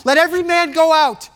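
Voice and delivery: high, loud acted command; crying out. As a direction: cry out to clear the whole room. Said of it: "And he cries".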